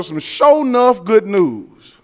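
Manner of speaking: slow Southern Georgia drawl on "show enough". Retold or imitated. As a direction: stretch "some show enough good news" out in a Georgia drawl. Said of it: "like you're gonna hear in Georgia".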